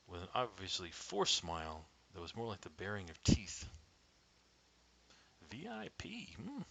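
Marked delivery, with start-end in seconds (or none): 5.5-6.6 skeptical muttering. Acted. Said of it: "I muttered".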